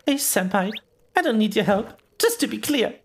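put-on high, huffy anime girl voice; tsundere. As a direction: act cold but clearly flustered. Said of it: "That's my tsundere voice".

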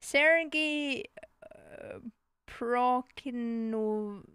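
slow, halting attempt at Prokofiev; unsure sounding-out. Real, not acted. Player attempting a hard composer's name, sounding it out slowly. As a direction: stretch Prokofiev out piece by piece, unsure. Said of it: "How the fuck do you say that name?".

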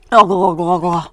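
loud garbled gargling squawk; witch's squawk. A narrator's reading. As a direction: squawk like a witch being shaken. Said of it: "she squawked".

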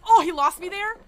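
high, very loud disbelief; real shocked, high-pitched yell; astonished outburst. Real, not acted. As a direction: burst out in amazed surprise. Killer unexpectedly lost her in chase.

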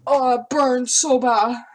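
loud pained groan, slow; burning mouth. Real, not acted. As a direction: gasp through the burn. After eating wasabi pea.

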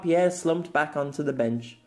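plain reading voice

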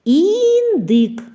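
Індик said high and loud, rising; word presented loudly. Shown as a demonstration. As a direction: call out Індик to the class. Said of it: "Де знаходиться звук І у слові індик?". (високо й голосно Індик)

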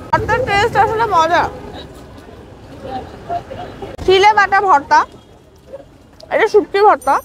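chewing while speaking; talking between bites of food; mouth full. Real, not acted. Seen 0: Puts food in mouth at -1.0, licks fingers, eats throughout.

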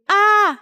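short narrated shriek of shock; acted scream. A narrator's reading. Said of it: "hét lên". (tiếng hét "A" cao giọng)

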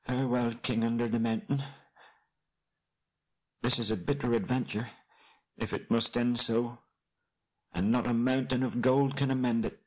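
sorrowful hobbit. Acted. Kneeling Bilbo taking leave of the dying king, high and sorrowful. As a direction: speak high and restrained, in sorrow.

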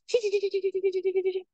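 high, fast dry rattling trill; chipping sparrow rattle. Shown as a demonstration. Teacher imitating a chipping sparrow's dry rattle for students. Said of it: "a bird that goes".

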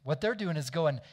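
usual speaking voice

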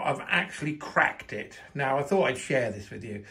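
normal talking voice

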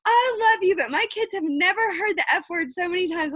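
high-pitched imitation of a sweet listener; sweet, cute lady. Lady messaging after the Zoom about swearing, sweet and high-pitched. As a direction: put on her cute voice, high, starting loud. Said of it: "she was so cute".

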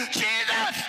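high, stretched-out cry; acted desperate shout; Bartimaeus shouting. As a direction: call out to be noticed. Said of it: "I gotta get his attention".

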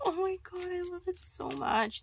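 high-pitched fangirl gushing; gushing delight. Real, not acted. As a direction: squeal it out, overcome with love for the gift. Gushing over stickers.

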